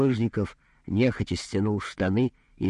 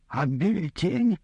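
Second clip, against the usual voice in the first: high, slow indignant question; protest, half outraged; almost indignant; 10 semitones higher, slower than usual. A narrator's reading. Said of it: "почти возмущённо произнёс полуподнявшийся Булыжников". (почти возмущённо)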